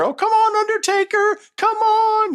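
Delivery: high, loud wailing cheer; hammed-up manager's high-pitched wail; Paul Bearer in character. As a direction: cheer on the wrestler in a piercing falsetto. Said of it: "back in character".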